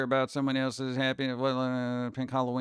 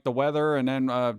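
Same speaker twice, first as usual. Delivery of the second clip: ordinary talk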